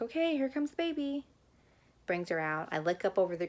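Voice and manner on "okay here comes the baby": doctor announcing. Retold or imitated. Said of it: "She's like".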